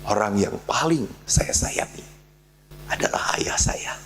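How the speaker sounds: near whisper of love; hushed confession. Real, not acted. Speaker telling the congregation he loves his father, hushed. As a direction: let it drop to a whisper.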